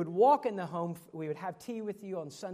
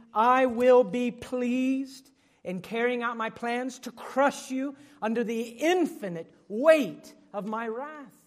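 higher, louder and slow, solemn divine voice; voice of God; 3 semitones higher, 8 dB louder, slower than usual. Acted. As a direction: proclaim it as God speaking judgment. God's words quoted from Isaiah.

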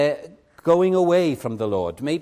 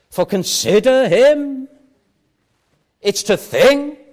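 emphatic preaching; 9 semitones higher, 8 dB louder, slower than usual. Acted. Preacher drives home key point.

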